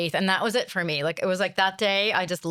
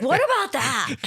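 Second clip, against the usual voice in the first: high, louder Rhode Island mom voice; mom's Rhode Island accent; 8 semitones higher, 4 dB louder than usual. Retold or imitated. Mom in the car imitated with a Rhode Island accent. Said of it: "she had this really intense Rhode Island accent".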